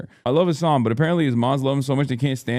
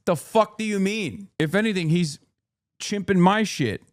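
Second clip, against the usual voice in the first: high-pitched, indignant retort; 7 semitones higher than usual. Real, not acted. Streamer rebuts claims he copied someone's style, indignant. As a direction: throw the accusation back, pitched up.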